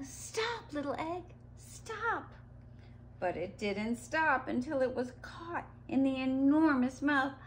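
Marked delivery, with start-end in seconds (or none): 0.0-2.3 mock yell, high and softened; narrated yell. A narrator's reading. Sid runs after the egg, soft high yell.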